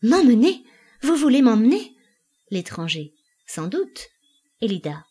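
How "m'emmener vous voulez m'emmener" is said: loud, high appalled questions; horrified. A narrator's reading. Elida recoils at being taken away, horrified. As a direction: recoil vocally, each question shooting up. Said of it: "reculant avec effroi".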